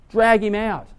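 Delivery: usual speaking voice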